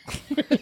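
high, soft laugh; quick high-pitched giggle. Real, not acted. Laughing at own story.

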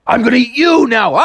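high, gleeful threat; menacing cartoon shark. Shark to frog, hammed-up threat. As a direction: threaten playfully, pitched well up.

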